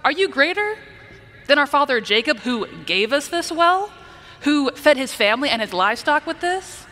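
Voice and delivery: high, incredulous, scoffing question; disrespectful challenge. Shown as a demonstration. Preacher voicing the woman challenging Jesus, disrespectful. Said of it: "she's not being respectful in her conversation".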